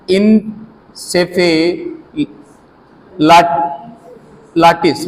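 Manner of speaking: इनसेफेलाटिस stretched very slowly, syllable by syllable; drawn-out term. Shown as a demonstration. Teacher saying a hard disease name for students to copy, very slow. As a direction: spell out इनसेफेलाटिस with your voice, each syllable held long. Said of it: "ऐसे आपको पढ़ना पड़ेगा".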